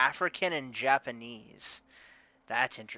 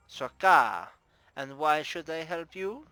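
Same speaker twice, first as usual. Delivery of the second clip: put-on loud opener, then higher Russian-accented question; 4 semitones higher, 7 dB louder than usual. Bar owner challenging the investigator, Russian accent. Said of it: "I'm doing a Russian accent".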